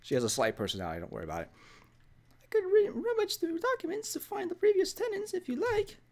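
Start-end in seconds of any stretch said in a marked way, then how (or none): 2.4-6.0 squeaky cartoon landlady, much higher; high-pitched cartoon old lady; Mickey Mouse voice. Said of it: "why do I sound like Mickey Mouse".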